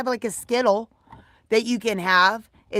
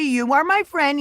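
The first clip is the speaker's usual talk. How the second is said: ordinary delivery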